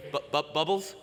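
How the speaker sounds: halting, stuttered question, lifting on the name; acted anxious stammer; stammered worried call. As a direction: stumble over the name as if the friend has vanished. Calls squirrel who fails to answer.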